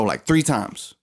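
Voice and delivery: normal talking voice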